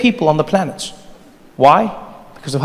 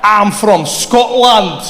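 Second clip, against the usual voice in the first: slow, higher mock Scottish accent; 5 semitones higher, much slower than usual. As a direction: insist you're a Scot, drawn out. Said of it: "speak to people in their accent".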